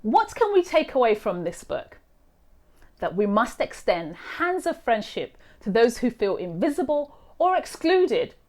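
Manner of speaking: usual speaking voice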